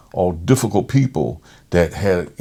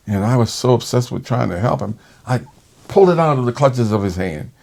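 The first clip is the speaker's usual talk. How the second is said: ordinary talk, nothing marked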